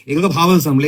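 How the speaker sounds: usual speaking voice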